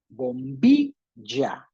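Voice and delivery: slow "bombilla", broken up with BI leaned on; stress on BI. Shown as a demonstration. Teacher locating the middle syllable, BI stressed in slow "bombilla". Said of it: "la del medio".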